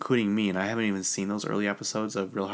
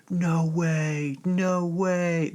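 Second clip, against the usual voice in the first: hammed-up disbelieving football player, pitched way up; impersonating football players; 9 semitones higher, much slower than usual. Sons hear mom got cast on Traitors, shocked high jock voice. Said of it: "This is me trying to impersonate football players".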